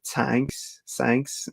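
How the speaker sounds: "tanks" with a hard t, "sanks" with an s for th; "tanks" and "sanks" as a non-native th slip; th slipping. Shown as a demonstration. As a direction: say "tanks" then "sanks", swapping the th for t then s. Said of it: "whenever I do, like, British accent, it goes into".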